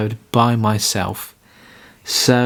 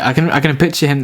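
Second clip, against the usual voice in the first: usual speaking voice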